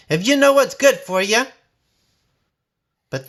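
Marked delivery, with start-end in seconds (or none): 0.1-1.5 much higher, louder hollering. A narrator's reading. Bessie hollers at the riders to run, loud and high. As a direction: holler them off the land. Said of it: "she hollered".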